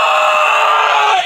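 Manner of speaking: very high, loud yelp; hammed-up yelp of pain; cartoon pain shriek; pain scream. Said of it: "and goes".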